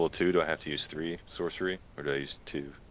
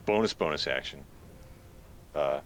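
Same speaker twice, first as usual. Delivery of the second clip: normal talking voice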